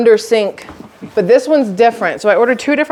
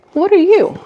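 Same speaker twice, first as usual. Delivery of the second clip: surprised; 11 semitones higher than usual. Real, not acted. Puzzled at unexpected second box.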